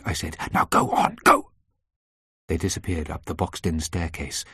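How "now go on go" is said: breathy, forceful urging; urgent order. A narrator's reading. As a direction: hurry them away at once. Urgently sends the women upstairs.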